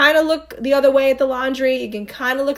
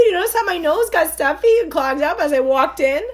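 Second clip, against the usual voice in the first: higher, sweet mock grandma voice; lightly voiced grandma, all sincere; a hint of a doting grandmother; imitating grandma; 8 semitones higher, 4 dB louder than usual. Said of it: "She goes".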